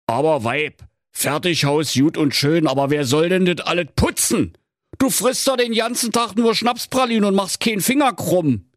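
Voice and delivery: protesting husband. Acted. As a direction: grumble back at the wife.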